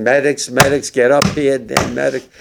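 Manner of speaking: shouting for help from outside the barracks, not full force; retold yelling for medics. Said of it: "started screaming again".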